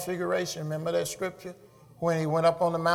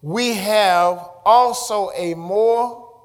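slow, loud, word-by-word proclamation; drawn-out, forceful scripture reading; preaching scripture; 4 semitones higher, 11 dB louder, much slower than usual. Acted. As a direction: stretch each key word out to the congregation.

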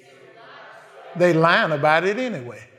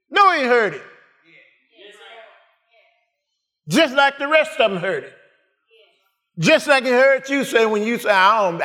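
full-force preaching; 7 semitones higher, 5 dB louder than usual. Real, not acted. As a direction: preach it out high, stretching the words.

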